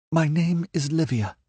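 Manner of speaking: put-on high, earnest girl's voice; frightened young woman. A narrator's reading. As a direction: captive introducing herself to a stranger. Livia's voice in narration.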